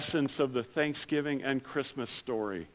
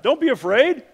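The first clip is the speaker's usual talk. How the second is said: "Don't be afraid" much higher and louder, booming; grand angel; 10 semitones higher, 10 dB louder than usual. Pretend. Pastor voices the angel to shepherds, booming.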